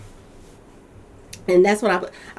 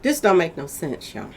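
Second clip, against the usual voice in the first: normal talking voice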